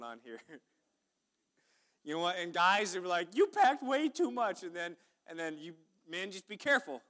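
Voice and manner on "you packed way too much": high, loud and drawn out; teasing imitation of a wife complaining; mock nagging wife. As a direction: ham up a scolding spouse. Wives quoted complaining.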